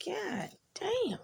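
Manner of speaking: high, stunned exclamation; amazed curse. Retold or imitated. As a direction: let it out in disbelief. Reacting to doctor's diagnosis.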